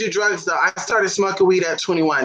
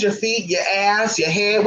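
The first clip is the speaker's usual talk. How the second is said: ordinary delivery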